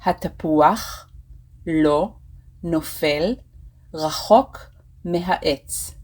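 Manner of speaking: slow teaching demo, word by word. Shown as a demonstration. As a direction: say it slowly, pausing between the words. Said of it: "I will say it slower".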